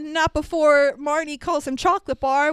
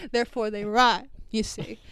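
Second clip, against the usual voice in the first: usual speaking voice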